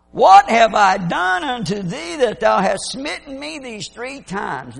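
talking donkey. Retold or imitated. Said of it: "This donkey turns around and starts talking".

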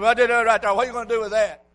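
put-on old preacher's retort. Old preacher throws the point back at Harvey, loud retort. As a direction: throw it back at him loudly. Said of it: "He said".

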